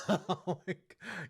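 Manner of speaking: slow oh my God through laughter; laughing in disbelief. Real, not acted. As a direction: laugh it out, stunned. Stunned by [name] news.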